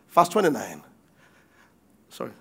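ordinary delivery

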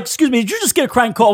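lightly voiced angry father; mock stern father. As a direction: play the indignant dad on the phone. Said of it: "pretend to be the guy's father".

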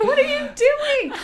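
very high, slow incredulous squeal; mock disbelief at a bad accent; drawn-out high what-are-you-doing. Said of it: "And I'm just like".

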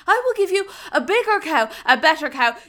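loud, eager bargaining; bargaining king voice. Acted. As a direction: push the offer loudly. Fear Griffin bargains.

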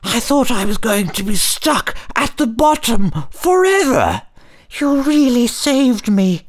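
very high grateful little voice; squeaky high tiny star. Acted. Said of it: "says the star".

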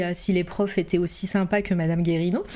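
ordinary delivery

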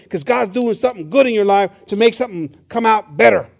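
loud, driving sermon delivery; forceful preaching. Real, not acted. Preacher urging church to see trouble as good, forceful preaching. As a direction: preach it hard and emphatically.

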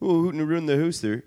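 high, loud cartoon Canadian accent; broad put-on Canadian accent; Bob and Doug McKenzie. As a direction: ham up a sketch-comedy Canadian. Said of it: "Bob and Doug McKenzie".

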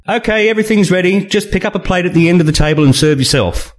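high, quick hostess voice; Julianne voice. A narrator's reading. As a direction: chirp it out brightly and fast. Julianne announces dinner.

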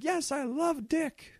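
high, slow, put-on proclamation; gleeful mock declaration. Imagining a castmate coming out openly today, gleeful declaration. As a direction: announce it proudly in a high mock voice. Imagined proud announcement in 2015.

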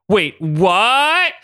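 high-pitched, startled double take; confused disbelief. Acted. Ryan and Logan realise in confusion, startled. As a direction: shoot up high on "what". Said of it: "Still in unison".

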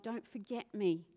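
normal talking voice

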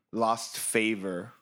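normal talking voice

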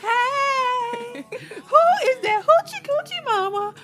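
shrill slow high wedding rant; shrill frantic demanding. Retold or imitated. Acting out wedding scene quote.

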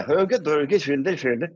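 high, slow mock-Swedish gibberish; Swedish Chef. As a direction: babble in a cod Swedish accent. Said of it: "It's like the Swedish Chef".

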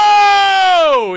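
high, loud amazed whoa; a crowd impressed by the reveal, amazed; mock crowd whoa. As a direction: call out whoa like a wowed crowd. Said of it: "Everybody said".

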